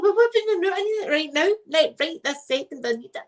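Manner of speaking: high-pitched, frantic plea retold; urgent demand. Patient demanding relief right now, urgent. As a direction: act out a desperate demand for relief.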